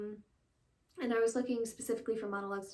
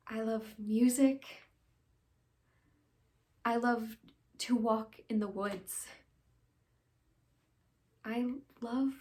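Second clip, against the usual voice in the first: reflective stage confession with long pauses; dramatic monologue. Acted. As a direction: confess haltingly, letting each thought settle. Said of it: "I'll be doing a monologue".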